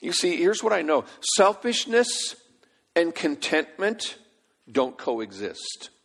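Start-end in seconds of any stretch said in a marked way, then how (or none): 1.4-5.9 slow preacher punchline; slow emphatic punchline. Acted. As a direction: land it word by word. Sermon punchline, slowed.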